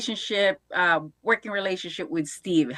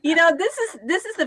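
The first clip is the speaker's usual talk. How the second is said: excited; 8 semitones higher, 6 dB louder than usual. Real, not acted. Host reacts with delight.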